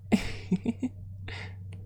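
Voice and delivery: high airy laugh; a light giggle at her own joke; unvoiced giggle; breathy giggle. Real, not acted. Laughs after niece joke.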